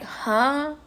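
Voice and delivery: put-on loud, incredulous girl what; disbelieving, drawn-out jealous of what; stunned confusion; baffled. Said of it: "I was like".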